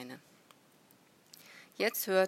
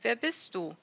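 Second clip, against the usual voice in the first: raised, higher challenge; loud questioning call; 5 semitones higher, 5 dB louder than usual. A narrator's reading. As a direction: demand an answer from a stranger. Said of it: "rief er auf Syrisch".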